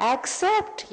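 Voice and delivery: "Accept" sung out high and drawn; emphatic answer. Real, not acted. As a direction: land "accept" as the big reveal, pitched high. Answering own question to audience. ("Accept" ऊँचे सुर में ज़ोर से)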